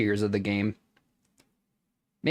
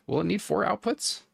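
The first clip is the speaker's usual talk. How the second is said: "four" pitched up high with doubt; puzzled rising question; 11 semitones higher than usual. Real, not acted. Unsure while calculating.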